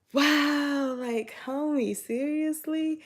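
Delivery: high, loud, drawn-out incredulity; exaggerated, stretched-out offended reaction; retold disbelief. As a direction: act out being stunned by an accusation. Retold disbelief at friend's accusation.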